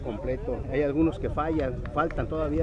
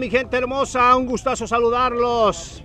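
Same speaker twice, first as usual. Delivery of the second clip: loud, high hype opener; excited announcer; 7 semitones higher, 7 dB louder than usual. Acted. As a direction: fire up the crowd. Hype opening to the crowd.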